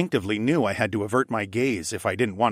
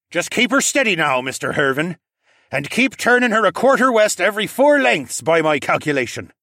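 higher, louder confident instructions; commanding raccoon captain; 8 semitones higher, 6 dB louder than usual. Acted. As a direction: direct the helmsman as a captain. Captain instructs first mate.

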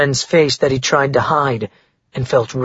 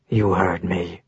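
low, soft, flat menace; quietly final, no emotion; cold and emotionless; 6 semitones lower, 6 dB quieter, slower than usual. A narrator's reading. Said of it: "voice was cold and emotionless".

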